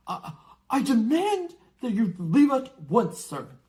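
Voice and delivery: a frightened man trying to sound firm; pompous uncle's nervous demand; blustering uncle. Acted. Uncle Vernon blustering at giant.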